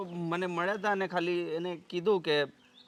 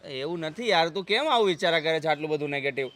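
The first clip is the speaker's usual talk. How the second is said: usual speaking voice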